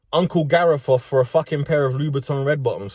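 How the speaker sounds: normal talking voice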